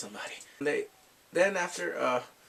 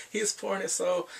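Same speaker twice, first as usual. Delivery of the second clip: ordinary delivery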